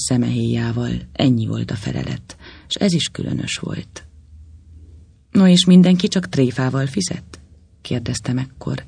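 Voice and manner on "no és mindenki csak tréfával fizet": innocent tone. A narrator's reading. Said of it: "igyekezve ártatlan hangsúlyt adni".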